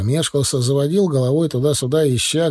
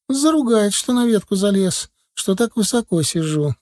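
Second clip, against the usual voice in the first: put-on worried little boy; 9 semitones higher than usual. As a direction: explain anxiously like a small boy. Boy fears father's scolding. (испуганный мальчик, высоким голосом)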